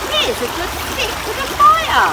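high, cheery call; a high voice finding firewood. Acted. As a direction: pipe it up high. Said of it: "the voice saying".

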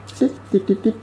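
high little "tit-tit" sound effects; playful ticking noises. Pretend. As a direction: chirp out quick high ticks. Imitated noises while adjusting.

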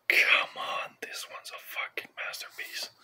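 breathy awed whisper, no voice. Real, not acted. Vlogger whispers his love for Videodrome to viewers, hushed. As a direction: lean in and whisper the praise like a secret. Raving about Cronenberg films.

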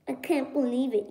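ordinary talk, nothing marked

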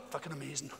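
sharp gasp then quick awed words; gasp then fast. Retold or imitated. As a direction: gasp in mock wonder, then rush it. Retelling stoned friend's pill story.

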